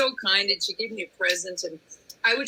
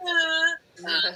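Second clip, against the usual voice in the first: star-struck stammer; 8 semitones higher than usual. Retold or imitated.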